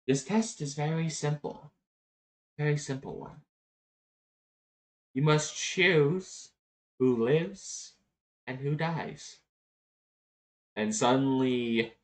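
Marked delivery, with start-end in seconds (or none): 0.1-9.4 higher, measured creature voice; solemn little pup. Acted. Pup announces the terms of the test.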